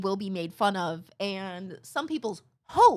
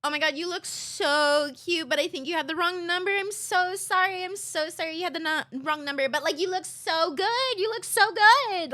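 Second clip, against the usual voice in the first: much higher, gushing and apologetic; gushing apology; 7 semitones higher than usual. Retold or imitated. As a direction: gush sweetly while apologising. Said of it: "I had to be like".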